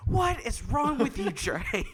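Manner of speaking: high, mock-shouted scolding; imitated exasperated mom. Mom scolding son over sixty dollars, mock exasperated shout. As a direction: shriek it lightly as a fed-up mother.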